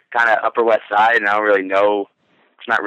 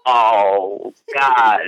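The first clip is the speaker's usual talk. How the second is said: drawn-out mock groan of dismay; retold dismay. Man hearing she is eighteen, hammed-up dismay. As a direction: act out an appalled reaction. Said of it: "And I literally did that".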